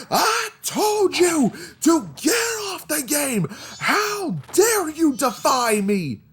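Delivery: high, slow, hammed-up furious scream; screaming stepdad. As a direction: drag out the outraged yell. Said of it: "he just started screaming like".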